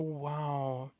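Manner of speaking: ordinary talk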